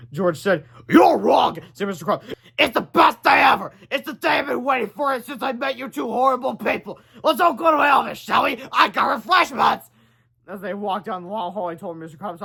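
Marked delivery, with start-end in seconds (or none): 0.9-9.9 high, loud gloating villain voice; gleeful principal. A narrator's reading. Mister Crumb greeting the boys, high loud gloating. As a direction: gloat over the boys with glee.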